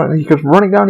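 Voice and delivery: ordinary talk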